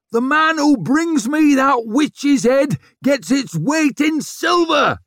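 loud, high roar, drawn out. A narrator's reading. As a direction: bellow the offer slowly to a whole army. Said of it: "he roared".

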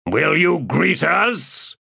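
high, slow, imperious call; loud demand. Acted. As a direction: demand entry from the doorway. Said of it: "he demanded in a loud voice".

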